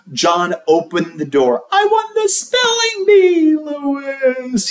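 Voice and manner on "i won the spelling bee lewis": put-on bragging kid. A narrator's reading. John to Lewis, a high bragging kid voice. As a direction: boast like a proud kid.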